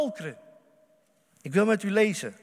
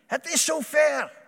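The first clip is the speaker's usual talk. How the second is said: higher, louder, slow joyful call; joyful proclamation; 6 semitones higher, 5 dB louder, slower than usual. Acted. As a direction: announce the good news slowly, rejoicing. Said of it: "Jezus zegt".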